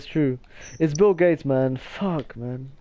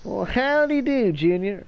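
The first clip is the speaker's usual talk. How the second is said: higher-pitched, badly faked southern accent; attempted southern accent; 4 semitones higher than usual. Shown as a demonstration. Giving the southern accent one more try, mock southern accent. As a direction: put on a hammy southern US accent. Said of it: "can you do a southern accent?".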